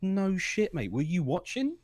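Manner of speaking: incredulous retort to critics; mock incredulous retort. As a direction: fire back in disbelief, laughing at the end. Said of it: "I'm like".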